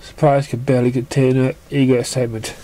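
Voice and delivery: ordinary delivery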